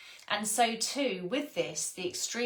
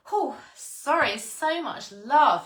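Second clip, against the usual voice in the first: higher, louder and slow, swept up in feeling; overcome with emotion; 7 semitones higher, 8 dB louder, slower than usual. Real, not acted. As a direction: let the love overwhelm you, slowly. Overwhelmed by love while reading.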